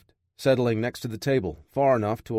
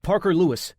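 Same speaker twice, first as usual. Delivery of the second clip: higher, halting frightened name; scared captive stammering out his name; 7 semitones higher than usual. A narrator's reading. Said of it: "he stammered".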